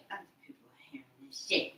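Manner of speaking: quick hushed warning whisper. Real, not acted. Whispered warning about listeners.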